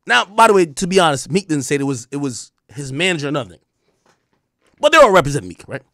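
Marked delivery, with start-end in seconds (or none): none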